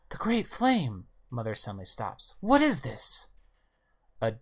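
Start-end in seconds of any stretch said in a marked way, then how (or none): 0.1-1.1 high-pitched startled mother; startled exclamation. Acted. Startled mother in voiced story. 2.4-3.3 high, loud startled mother; startled question. Acted. Mother startled by looming robot.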